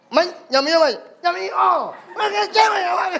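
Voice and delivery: both nasal voices, laughing through it; two nasal men talking past each other; nasal speech imitation. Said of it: "sama bindengnya".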